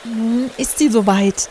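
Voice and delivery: ordinary delivery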